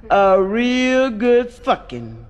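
drawn out slowly, word by word; lingering slow delivery; slowed for emphasis. Acted. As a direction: stretch it out for the punchline. Comic poem line drawn out.

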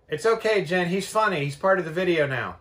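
loud, high call through the door. Real, not acted. Calling out to Jen about the cat, loud and high. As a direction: call out so Jen can hear you.

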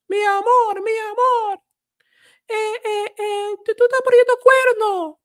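high, excited Dominican Spanish woman's voice; Dominican accent shadowing. Retold or imitated. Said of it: "They're doing a lot of shadowing as well again".

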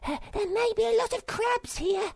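very high, loud monkey voice; high-pitched creature calling out; excited cry. Acted. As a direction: cry out like an eager little monkey. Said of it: "she cried".

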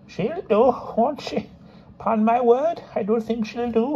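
put-on high, thin old crone voice; old woman voice. Old woman sizes up narrator.

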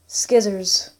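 "Skizzers." with hard sk at the start; own pronunciation. Shown as a demonstration. Gamer letting viewers hear the difference, "Skizzers." with hard sk. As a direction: put a k after the s in "Skizzers.". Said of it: "I call scissors skizzers".

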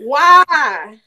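drawn-out Southern "why"; exaggerated Southern twang; Southern accent. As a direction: stretch it into a Southern country wail. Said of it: "The accent shift".

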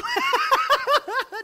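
high, loud burst of laughter. Real, not acted. Preacher to congregation, loud laugh at own joke.

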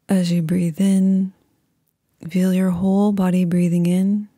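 calm, unhurried meditation voice with long pauses; guided meditation. Acted.

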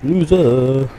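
loud mocking jeer; taunting. Real, not acted. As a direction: gloat at him from safety. Taunting the enemy after escape.

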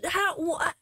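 usual speaking voice

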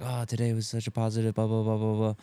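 preachy social-media post trailing into blahs; mock preachy. Mocking his old online posts, preachy. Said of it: "not be preachy online and being like".

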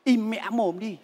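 low, soft, urgent shush; husband hushing his shouting wife, low and soft; hushed scold. Acted. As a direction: cut her off quietly so nobody hears.

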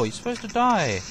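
usual speaking voice

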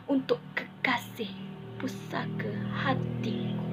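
ordinary talk, nothing marked

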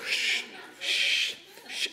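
breathy "shh" swooshes; repeated airy swinging noise; imitated swinging whoosh. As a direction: whoosh out each swing of a trapeze. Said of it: "it's swinging like this".

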